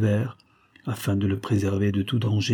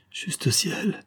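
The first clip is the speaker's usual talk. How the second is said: cry of fright; 9 semitones higher than usual. A narrator's reading. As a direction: gasp it out in dismay. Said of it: "cria la comtesse". (cri d'effroi, léger)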